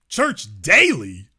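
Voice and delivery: low, slow incredulous echo; deadpan disbelief. Real, not acted. Host echoing the article, low disbelief. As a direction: repeat it like it's absurd.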